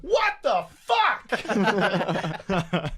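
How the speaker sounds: high, very slow, loud laughing disbelief; shocked disgust. Pretend. Imagining the girls finding puke.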